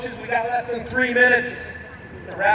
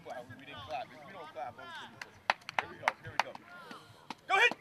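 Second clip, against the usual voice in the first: ordinary delivery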